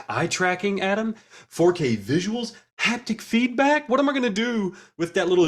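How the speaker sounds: high rising awe; excited. Real, not acted. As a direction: gush over each feature. Listing features in awe.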